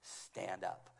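gentle backstage countdown; soft countdown. Retold or imitated. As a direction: count him in calmly. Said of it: "It was that clear".

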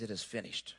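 low, faint last breath. Retold or imitated. Jesus dying on the cross, faint and low. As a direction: barely let it out as if dying. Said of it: "in his last breaths".

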